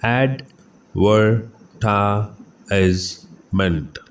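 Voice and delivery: Advertisement drawn out very slowly; teacher slowly modelling the second pronunciation for students; slow syllable by syllable. Shown as a demonstration. Slow, careful pronunciation drill. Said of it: "इसकी दूसरी pronunciation है".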